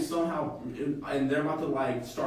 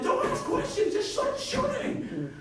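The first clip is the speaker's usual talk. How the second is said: very high squeaky voice, laughing; a cartoonish squeaky shout, then slow on shooting; frantic order. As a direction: shriek the order through a laugh. Reply shouted during chase.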